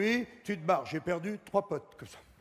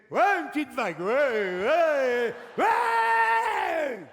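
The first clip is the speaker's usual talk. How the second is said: building from excited to a high screaming ouais; a toilet ball riding the wave, cheering louder and louder until it screams; the little ball cheering; 8 semitones higher, 7 dB louder, much slower than usual. Acted. The ball rides the flush wave, building to a high shout. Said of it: "elle fait".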